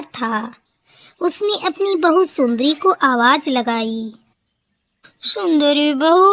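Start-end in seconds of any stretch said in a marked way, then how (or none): 5.2-6.3 put-on frail old crow voice, faintly tearful; a sick old mother calling feebly; weak first call. A narrator's reading. Sick old crow calls daughter-in-law. (बीमार बूढ़ी की कमज़ोर पुकार)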